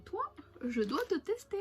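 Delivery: high, singsong mock vow to a snack; eager acted reaction. Shopper telling a snack she must try it, eager acted reaction. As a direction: play up being tempted, pitch jumping high. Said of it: "j'ai vu ça, j'ai fait".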